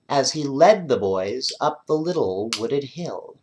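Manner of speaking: ordinary delivery, nothing marked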